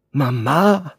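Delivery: high, loud exclamation; startled cry. A narrator's reading. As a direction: cry out at the mention of mother. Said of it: "rief das Mädchen".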